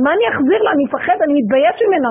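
high, loud worried pleading; father panicking over a neighbor's car; anxious fretting. Retold or imitated. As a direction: fret aloud in panic. Father frightened about car.

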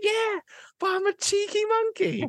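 very high-pitched cheeky comeback; cheeky monkey. Acted. Cheeky monkey excusing himself to his parents, very high cheeky voice. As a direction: squeal it as a shameless excuse.

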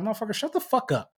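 put-on exasperated rant at a celebrity kid; imagined telling-off. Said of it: "'cause it's like".

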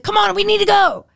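high, loud hurry-up call; mock urgency. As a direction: imitate rushing off with the loot. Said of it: "comes running out with it".

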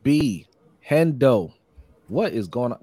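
ordinary delivery

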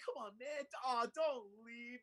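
high-pitched pleading imitation; mock pleading. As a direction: beg them to stay, drawn out at the end. Acting out a man pleading.

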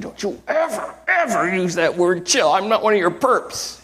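high, mock outraged wife's voice. Wife snapping at her husband who told her to chill, mock outrage. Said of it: "she just went ballistic".

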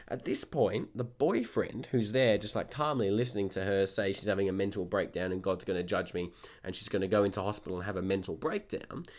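usual speaking voice

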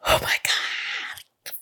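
breathy, drawn-out whisper; gasp, then slowly whisper it in disbelief; stunned gasp. Retold or imitated. Store owner retelling her reaction to the call, stunned whisper.

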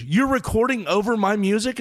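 outraged at the insult; indignant. Retold or imitated. Star offended by taping over.

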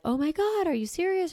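high gasping disbelief; shocked friend. Retold or imitated. Friend hearing about the coyote chase, high shocked disbelief. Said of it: "And they're like".

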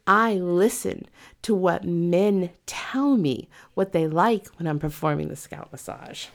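usual speaking voice

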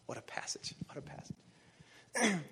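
slow hushed whisper of wonder; awed whisper. Real, not acted. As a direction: breathe it out in awe. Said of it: "awed whisper over the passage".

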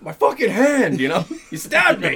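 loud high pained yell, breaking into a laugh; hammed-up howl over a stabbed hand; dad yelling in pain. Stabbed dad howls at his son, mock pain. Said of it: "and dad's like".

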